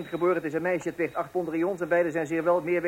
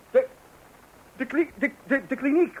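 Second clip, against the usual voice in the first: flustered stammer; 8 semitones higher than usual. Acted.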